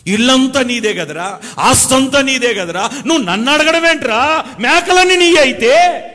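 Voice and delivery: building from slow tender call to a high loud cry; pleading father. Retold or imitated. Father pleading with his elder son, rising to a loud cry. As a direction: rise into an exasperated father's outcry.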